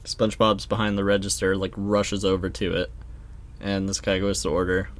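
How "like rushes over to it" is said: normal talking voice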